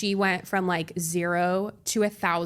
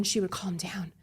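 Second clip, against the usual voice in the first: whisper; 7 dB quieter than usual. Real, not acted. Hushed reflection.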